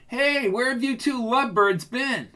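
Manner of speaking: bright, high, breezy greeting; cheerful young woman. A narrator's reading. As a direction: tease them with a light, high voice. Roz voiced, cheerful.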